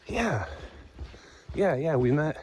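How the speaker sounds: imitating a hiker's pleased recognition; retold excited recognition. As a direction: act out suddenly recognizing someone. Retold delighted recognition of each other.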